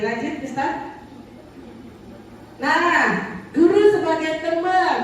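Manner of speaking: normal talking voice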